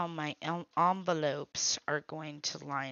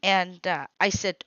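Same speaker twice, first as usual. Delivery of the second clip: normal talking voice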